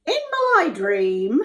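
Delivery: high, slow, loud character voice; cupbearer voice. Acted. Cupbearer tells Joseph his dream, in character voice. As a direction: put on a drawn-out voice for the cupbearer. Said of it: "Now we can do voices".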